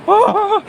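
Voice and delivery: sudden high shriek of fright; startled scream. Real, not acted. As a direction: scream in real shock.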